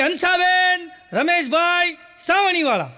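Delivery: high shout of names; loud shouting. Retold or imitated. Neighbour shouting names again at the door, loud and high. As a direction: call the names out loudly again. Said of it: "એટલું બધું જોરથી બોલ્યું ને".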